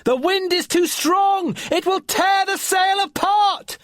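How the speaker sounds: high, loud storm shout; yelling. Acted. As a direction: shout against the gale. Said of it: "yelled Finn".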